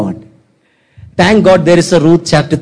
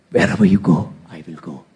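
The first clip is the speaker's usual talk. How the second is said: very low, softening pledge; acted grave, hushed devotion; solemn vow; 11 semitones lower, 7 dB quieter than usual. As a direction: drop deep and fade to a near murmur. Acts Ruth's solemn vow to Naomi.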